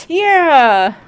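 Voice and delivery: big drawn "Yeah!" full of pep; energized heroine voice. Shown as a demonstration. Reader demonstrating the heroine's voice with hand motions, energized. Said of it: "Every time I do her voice".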